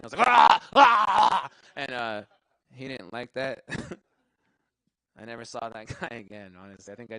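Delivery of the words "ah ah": loud high fake seizure cries; hammed-up startled screams, much louder; mock convulsing yells. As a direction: yell like you're faking a fit. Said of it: "I just started convulsing".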